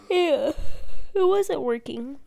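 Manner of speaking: talking through a yawn; words stretched by a yawn. Real, not acted. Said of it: "Sorry for the yawn".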